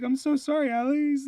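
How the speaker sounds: put-on high, sing-song kid apology; sweet little-kid sorry; childish apology. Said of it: "I'm like".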